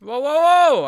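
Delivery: loud, high shocked whoa; startled whoa. Real, not acted. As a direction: yelp in surprise. Startled by game accident.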